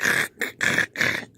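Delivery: repeated rattling bursts of mouth noise; imitated janky speaker. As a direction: sputter like a broken sub at low volume. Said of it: "sounds pretty janky though. It's like".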